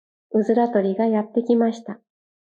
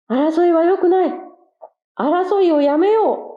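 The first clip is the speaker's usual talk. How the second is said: king quail appeal; 8 semitones higher, 3 dB louder than usual. Acted. King quail calls for peace.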